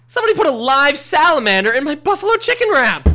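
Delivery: normal talking voice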